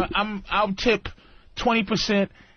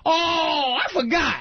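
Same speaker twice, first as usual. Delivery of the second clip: diner waitress; 11 semitones higher, slower than usual. Retold or imitated. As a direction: call it out like a scatterbrained waitress. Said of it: "Mel's Diner waitresses".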